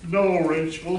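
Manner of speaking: normal talking voice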